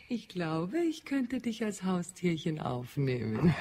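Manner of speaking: neckend, durch Lachen hindurch; lachend. Acted. Neckt Sal lachend. (lachend neckt sie Sal)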